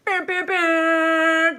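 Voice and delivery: high, loud, slow horn imitation; mock horn honk. Imitating the Ham Horn app she'll press during the reading, slow and loud. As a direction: honk the words out like a toy horn. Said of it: "I'm gonna be going".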